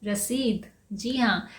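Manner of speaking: ordinary delivery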